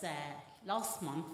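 usual speaking voice

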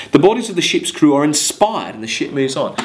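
measured verse reading to the class; poem reading. Acted. Teacher reading Coleridge verse to students, measured poem reading. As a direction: read it like a poem, letting lines ring.

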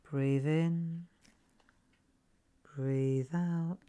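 slow, calm guided-breathing voice; meditation voice. Shown as a demonstration. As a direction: ease them into the breath like a meditation tape. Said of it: "my voice gets slower".

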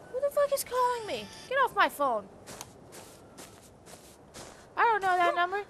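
irritated snap. Real, not acted. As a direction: brush off the caller sharply. Annoyed by phone call.

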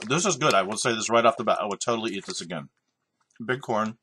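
muffled crunchy chewing speech; chewing while talking. Real, not acted. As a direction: mouth full of corn. Seen 0: Puts corn in mouth at -3, chews with closed mouth, adds more at 7.5.

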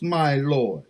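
ordinary talk

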